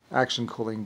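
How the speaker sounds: normal talking voice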